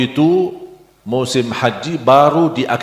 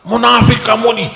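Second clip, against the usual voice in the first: angry accusation; 11 semitones higher than usual. Retold or imitated. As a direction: hurl the insult at him in anger. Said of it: "marah dia banget tuh". (menirukan orang marah besar menuduh)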